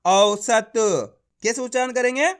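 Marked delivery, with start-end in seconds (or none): 0.1-0.7 औसत joined up, slow and loud; blended syllables. Shown as a demonstration. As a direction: join औ-स-त into one stretched, emphatic औसत. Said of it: "तो मिलके बन जाएगा".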